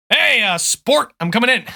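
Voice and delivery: hearty dad announcing himself at the door; father calling in. Acted. Father calls to his son through the door, casual call. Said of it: "your father steps in".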